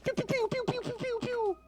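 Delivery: gun noise. Pretend.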